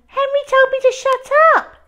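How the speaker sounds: very high, loud tattletale wail. A narrator's reading. Peter complaining about Henry to Mum, high wail. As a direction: cry out for Mum. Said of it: "wailed Peter".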